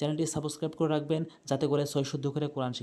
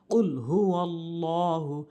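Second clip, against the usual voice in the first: slow recitation with held long vowels; Quran recitation; much slower than usual. Shown as a demonstration. Teacher building up the verse for learners, slow recitation. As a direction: recite slowly, syllable by syllable.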